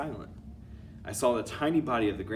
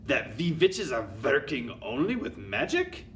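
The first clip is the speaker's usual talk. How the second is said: louder contemptuous put-on accent; thundering scorn; 5 semitones higher, 5 dB louder than usual. Grand High Witch scolding her witches, thundering. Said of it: "she shouted at them".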